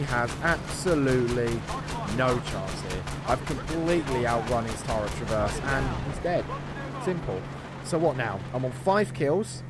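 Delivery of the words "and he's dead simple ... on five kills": ordinary delivery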